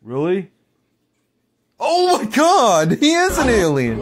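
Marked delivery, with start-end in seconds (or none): 1.8-4.0 shocked. Real, not acted. Shocked reaction to meme.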